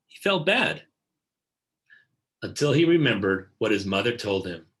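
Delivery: plain reading voice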